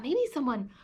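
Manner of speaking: put-on hushed whisper to children; soft, unvoiced sneaky whisper. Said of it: "a little bit quieter next".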